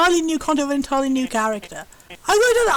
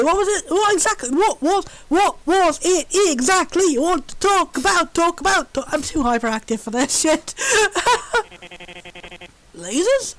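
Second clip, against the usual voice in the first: ordinary talk, nothing marked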